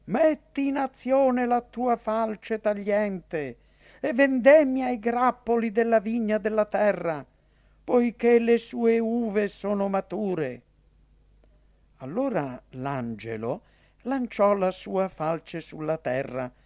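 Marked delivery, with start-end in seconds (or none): 0.1-10.6 higher, sustained proclamation of an angel; raised-voice narrated cry; crying out loudly. A narrator's reading. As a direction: read it as a solemn command called out from on high. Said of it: "gridò a gran voce".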